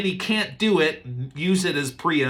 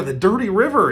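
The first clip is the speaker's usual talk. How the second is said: higher, louder scorn on dirty river; disgusted; 8 semitones higher, 6 dB louder, faster than usual. Retold or imitated. Proud Naaman sneering at the river, louder. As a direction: spit out the words in disgust.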